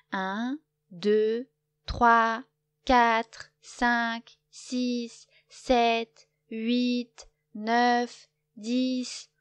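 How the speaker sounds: loud, proud counting rising at the end. A narrator's reading. Loup counting proudly so everyone hears. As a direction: count it out proudly for all to hear. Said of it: "il compte fièrement".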